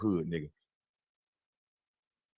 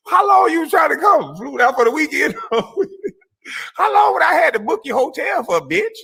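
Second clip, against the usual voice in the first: mocking laughing shout; 13 semitones higher than usual. Real, not acted. Laughing at a rival, he shouts mocking questions. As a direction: shout the taunt through laughter.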